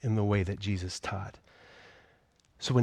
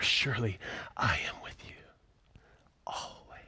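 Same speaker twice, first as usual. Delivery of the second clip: whispered promise; 6 dB quieter than usual. Acted. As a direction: breathe it like a secret. Quoting Jesus' last words.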